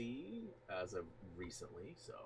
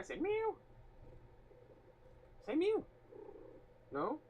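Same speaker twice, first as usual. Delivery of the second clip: much higher, sing-song coaxing. Real, not acted. Streamer coaxing his cat to meow for chat, high cooing. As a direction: coo up high like asking a kitten to meow.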